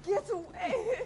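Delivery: sharp desperate yell; shout. Acted. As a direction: scream at him to stop. Mother fights him off.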